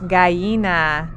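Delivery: ordinary talk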